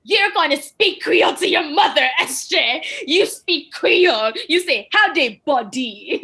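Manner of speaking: high, loud mock British dad; imitating a British husband scolding; British father. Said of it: "being the British guy that is".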